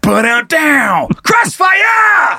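high, shouted ad announcer; commercial announcer shout. Pretend. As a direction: yell it like a nineties cereal ad. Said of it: "nineties commercial".

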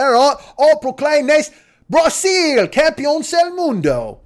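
hammed-up British accent, high and drawn out. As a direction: proclaim it grandly in a mock English accent. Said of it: "he probably should have talked like this, like [name]".

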